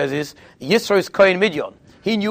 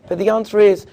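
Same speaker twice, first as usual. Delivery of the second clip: usual speaking voice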